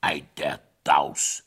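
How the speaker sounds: low, slow astonishment; astonished king. A narrator's reading. King amazed at the fleeing hare, low and slow. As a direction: draw it out low, marvelling. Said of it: "staunte der König".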